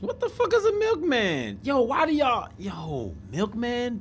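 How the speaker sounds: high, incredulous squeal of disbelief; hearing a strange slang word, a high baffled cry; baffled shock. Real, not acted. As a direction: shoot way up in genuine confusion. Baffled by a new term.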